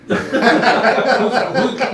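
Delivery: laughing through the words. Real, not acted. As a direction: talk while still laughing at the joke. Laughing at the joke.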